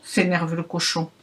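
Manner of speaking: plain reading voice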